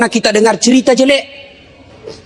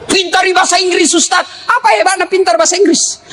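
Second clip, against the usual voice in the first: preaching shout; 7 semitones higher than usual. Real, not acted. Preacher shouting at the congregation, mocking. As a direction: shout the question at the crowd. Preacher mocks English pride.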